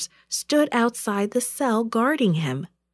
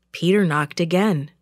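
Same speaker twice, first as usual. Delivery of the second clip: ordinary delivery, nothing marked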